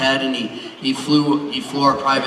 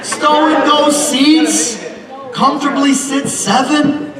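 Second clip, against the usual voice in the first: mock car-ad boast; 13 semitones higher, 4 dB louder, slower than usual. Comic sales pitch for minivan.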